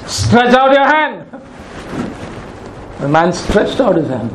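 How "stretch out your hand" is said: higher and slow, insistent order; preacher imagines telling the withered man, slow insistent command; imagined command. Pretend. Push the words out slowly, insisting. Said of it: "Just imagine telling a man".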